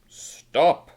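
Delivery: loud superhero stop; heroic command. Acted. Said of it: "And Super Pete says".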